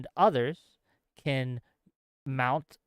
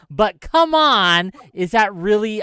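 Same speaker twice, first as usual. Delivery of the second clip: ordinary delivery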